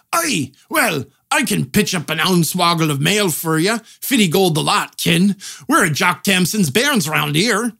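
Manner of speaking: hammed-up Scottish blacksmith; Scottish accent. Blacksmith quotes a price for mail, Scottish accent. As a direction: lay on a thick stock Scots shopkeeper brogue. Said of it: "They put on a Scottish accent".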